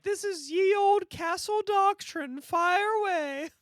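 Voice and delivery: high, slow storybook fairy voice; old fairy voice. Retold or imitated. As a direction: float it up high like an ancient fairy. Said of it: "She was like".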